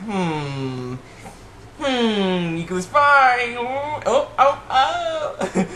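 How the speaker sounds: drawn-out hum climbing into high oohs; hammed-up suspicion, stretched and rising; mock suspicious. Viewer eyeing a suspicious suspect, mock suspicion. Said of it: "looking suspicious".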